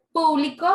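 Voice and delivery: PÚ-blico, loud opening syllable; público launched loud on its first syllable; stress on first PÚ. Shown as a demonstration. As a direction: punch the PÚ in público. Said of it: "toda la fuerza de voz".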